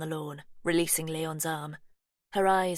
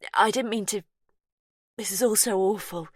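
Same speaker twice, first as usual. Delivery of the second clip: distressed apology, higher and louder; upset; 4 semitones higher, 6 dB louder than usual. Acted. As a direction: blurt it out, upset and guilty.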